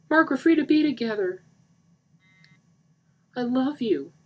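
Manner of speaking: high, loud pleading; lovestruck plea. Acted. Lisa confessing love to Mark, pleading. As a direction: offer yourself to him.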